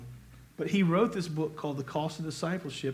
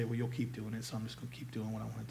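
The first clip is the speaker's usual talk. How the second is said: much softer, rushed, shrugging mock excuse; mock dismissive; 6 semitones lower, 11 dB quieter, faster than usual. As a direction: toss it off like a careless sinner. Mocking a careless believer's excuse.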